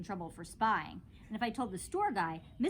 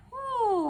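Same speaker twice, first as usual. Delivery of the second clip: whew; 9 dB louder than usual. A narrator's reading. Relief after long speech.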